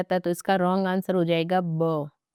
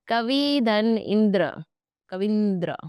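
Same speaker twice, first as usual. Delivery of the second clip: slow split; 3 semitones higher, slower than usual. Shown as a demonstration. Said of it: "टुकड़ा मैं कर देती हूँ".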